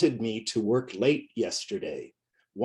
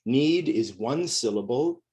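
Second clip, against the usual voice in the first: ordinary delivery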